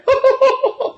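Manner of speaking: a short high real laugh; high burst of laughter. Real, not acted. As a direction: laugh at your own joke. Laughs at his own parody.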